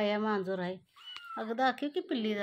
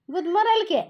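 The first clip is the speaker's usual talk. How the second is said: higher, louder alarmed "गुदमरेल की"; alarmed cry; 8 semitones higher, 8 dB louder than usual. Real, not acted. Cat lying on kittens, alarm. (घाबरून मोठ्याने)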